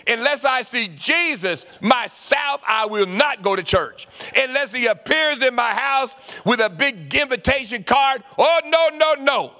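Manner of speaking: hammed-up refusal, no no no; stubborn Thomas. Thomas refusing to leave lockdown, loud and high. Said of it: "he goes".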